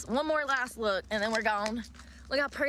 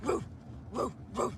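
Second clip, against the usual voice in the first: howling; 4 dB quieter than usual. Pretend. Howling at full moon.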